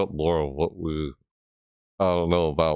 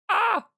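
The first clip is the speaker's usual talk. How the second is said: sudden yelp. Real, not acted.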